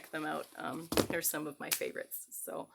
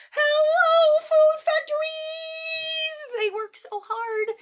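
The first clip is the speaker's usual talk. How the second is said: much higher and louder, long drawn hello; cheerful shout; 14 dB louder, slower than usual. Shown as a demonstration. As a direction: call out to the farmers.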